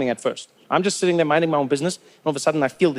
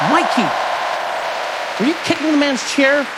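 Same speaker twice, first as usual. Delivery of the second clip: high, loud, incredulous questioning; shocked American dad; 8 semitones higher, 3 dB louder than usual. Acted. Dad asking Mikey about the kicked chair, shocked and high. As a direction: question the child in raised-pitch disbelief.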